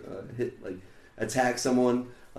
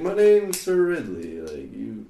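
pompous vain hero; 4 dB louder than usual. Retold or imitated. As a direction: play a pompous knight bragging. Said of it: "pompous knight".

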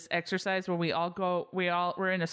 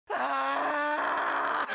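retold scream; 10 semitones higher than usual. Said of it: "screamed at the top of their lungs".

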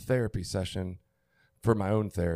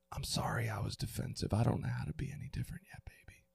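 near-whispered, softer apology; tender apology; 7 dB quieter than usual. Retold or imitated. Father imagining an apology to his daughter, tender whisper. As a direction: whisper it gently, fading away.

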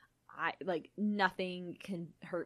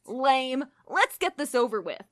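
put-on gruff, slightly louder teen boy; 5 semitones higher, 7 dB louder, slower than usual. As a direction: grumble it like a sulky teen. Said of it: "Beast Boy gruffed".

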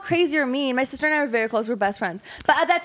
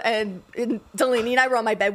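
usual speaking voice

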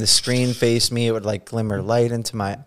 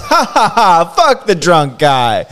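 laughing mockery; 5 semitones higher, 6 dB louder, slower than usual. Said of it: "Laughed at my boss".

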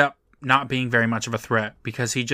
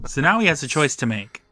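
normal talking voice

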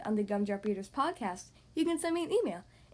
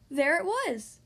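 gasp, then slow, higher wonder; awed discovery; 5 semitones higher, 4 dB louder, slower than usual. A narrator's reading. Squirrel finding the golden acorn, awed. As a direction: reveal it slowly in amazement.

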